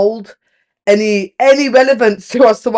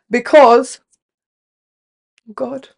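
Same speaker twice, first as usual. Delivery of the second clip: starting to cry; slower than usual. Real, not acted. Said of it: "I don't even know I'm crying".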